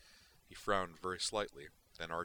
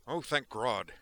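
grunted; 6 semitones higher, 9 dB louder, slower than usual. Acted. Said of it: "Duke grunted".

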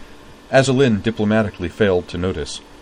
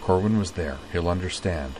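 murmured; 7 dB quieter than usual. Acted. Utna telling Kate to call if lonely, murmured softly. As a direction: murmur it gently to a friend. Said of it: "Utna murmured".

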